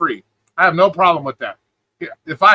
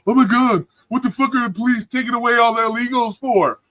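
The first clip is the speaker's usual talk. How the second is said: higher-pitched mimicry of outraged whiners; mock their fretful protest, pitched up; mock complaining; 6 semitones higher than usual. Streamer mimicking people upset at police removals, mock complaining. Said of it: "now they're complaining about that".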